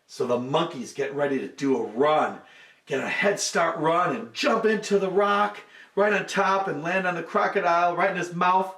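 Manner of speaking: usual speaking voice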